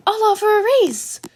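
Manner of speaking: chirpy little principal pitching in; Nezu voice. Acted.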